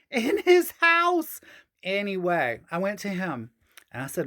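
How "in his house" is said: high, slow giggling repeat; laughing. Real, not acted. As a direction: laugh it out slowly. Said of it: "laughs, repeats".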